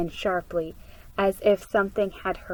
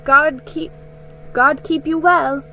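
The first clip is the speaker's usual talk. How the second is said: higher, louder blessing called after them; calling out; 5 semitones higher, 5 dB louder than usual. A narrator's reading. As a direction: call it out as they walk away. Women calling farewell.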